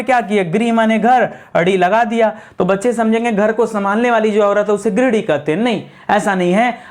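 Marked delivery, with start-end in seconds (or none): none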